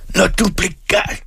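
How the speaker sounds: very high, strained yell; narrated father flaring up; enraged shout. A narrator's reading. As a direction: explode at the boy in fury. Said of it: "råbte den gamle".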